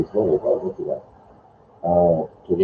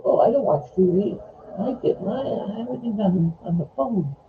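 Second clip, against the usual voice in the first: high, thin, quieter put-on voice; squeaky mock of people too good for TV; mocking impression of people; 5 dB quieter than usual. Mimics people who don't watch TV.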